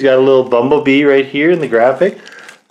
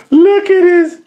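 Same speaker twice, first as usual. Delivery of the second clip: high laughing burst; 4 dB louder, slower than usual. Real, not acted. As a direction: laugh it out in amusement. Laughs at tiny arms.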